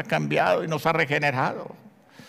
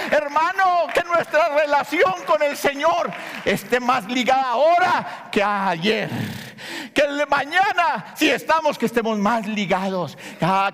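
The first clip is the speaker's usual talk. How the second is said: preaching at full force; 9 semitones higher than usual. Acted. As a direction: plead with the congregation, voice raised high. Fervent exhortation and prayer. (predicación ferviente a todo pulmón)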